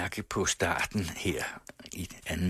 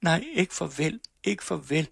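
much higher, whimpering refusal to say goodbye; 11 semitones higher than usual. Acted. Laura whimpers at Mogens as he says goodbye. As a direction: whimper like a child who won't let go.

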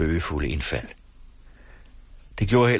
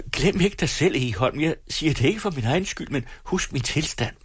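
high-pitched, urgent mother's protest; pleading mother; 9 semitones higher than usual. Acted. Expectant wife warning her raging husband, pleading. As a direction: caution him, then plead, pitched well up.

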